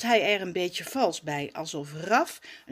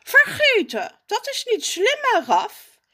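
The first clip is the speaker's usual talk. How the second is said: much higher, louder smug jab; condescending; 8 dB louder than usual. Acted. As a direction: talk down to the cousin. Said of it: "glimlacht minzaam".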